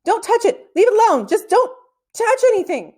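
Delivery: frantic, beg him to leave it; panicked begging. Acted. Panicked boy begging friend.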